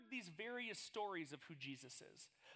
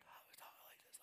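whispery voice gone from shouting; lost voice; 15 dB quieter than usual. Retold or imitated. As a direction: imitate a voice worn out from camp. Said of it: "if my voice was like".

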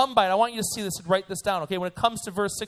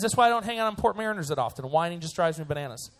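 ordinary talk

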